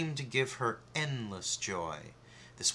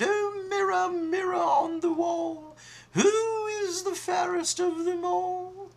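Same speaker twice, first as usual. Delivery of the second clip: much higher, louder spoiled queen cry; shrieking queen; 6 dB louder than usual. Acted. Spoiled queen demanding the mirror praise her, shouting. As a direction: screech it like a vain queen. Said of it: "the spoiled and stupid queen would shout".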